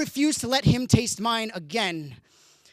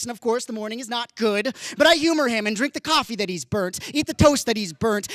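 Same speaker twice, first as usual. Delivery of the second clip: ordinary talk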